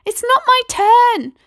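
put-on high, loud indignant child; protesting girl. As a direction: protest hotly.

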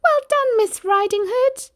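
very high, loud piggy cheer; yelling pig. A narrator's reading. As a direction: squeal congratulations at the top of the voice. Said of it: "stood and yelled".

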